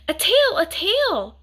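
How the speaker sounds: high, slow, excited calling out; excited cry. A narrator's reading. Boy calling to grandfather, slow high excited cry. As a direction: shout it up high, thrilled to spot it. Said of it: "George cried".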